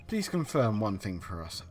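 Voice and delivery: ordinary talk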